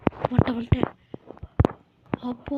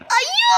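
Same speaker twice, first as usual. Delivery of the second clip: very high, loud wailing scream; long panicked howl; startled wail; 6 dB louder, much slower than usual. Real, not acted. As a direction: shriek as if knocked off a ledge. Shoved off in game.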